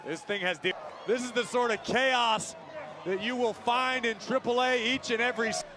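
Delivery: lively play-by-play call; wrestling commentator. Acted. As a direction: call the match as it happens.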